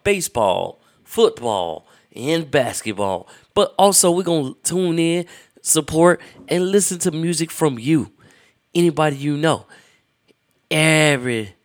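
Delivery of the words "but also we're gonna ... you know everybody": excited. Acted. Hyped host pitch.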